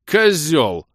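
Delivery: high, loud one-word insult; angry shout. Acted. Влас insults friend over the phone, shouting. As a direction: hurl it at him.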